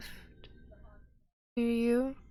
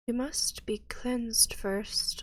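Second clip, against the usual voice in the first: slow unvoiced whisper; 3 semitones higher, slower than usual. Priestess whispering the cleansing rule to the party. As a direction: hush it out slowly like a rite.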